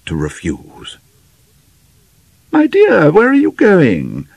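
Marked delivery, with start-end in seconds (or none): 2.5-4.3 high, raised voice of a wondering mother; puzzled mother calling out in a high voice; simple wonder. A narrator's reading. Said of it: "asked her mother with simple wonder".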